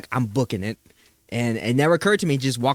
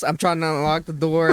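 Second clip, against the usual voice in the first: ordinary talk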